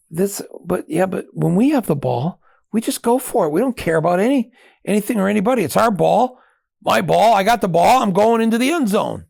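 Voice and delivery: high, loud, swaggering claim to the ball; cocky excitement. Retold or imitated. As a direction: brag like it's your ball. Cocky American football logic.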